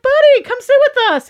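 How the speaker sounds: high, loud, eager invitation; friends calling over. Retold or imitated. Friends inviting the star to their table, loud and eager. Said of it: "they're like".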